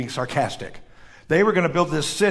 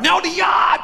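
high, shouted R-less Boston "yard"; Boston accent; 6 dB louder, slower than usual. Retold or imitated. Bostonian correcting the listener, shouting Boston accent. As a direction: insist loudly on "yard" in broad Bostonian.

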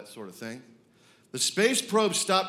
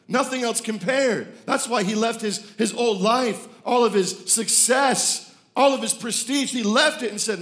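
high, loud, building pulpit fervor; impassioned preaching; 9 semitones higher, 10 dB louder than usual. Real, not acted. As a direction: proclaim it with full force, punching key words. Preaches at full force.

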